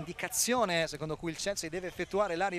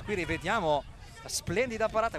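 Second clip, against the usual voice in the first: ordinary delivery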